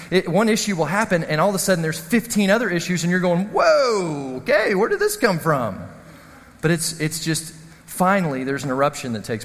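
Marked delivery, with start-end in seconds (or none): 3.5-5.8 long drawn whoa, then baffled question; taken aback. Acted. Partner facing a mute's sudden pile of issues, startled. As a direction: act out being caught off guard.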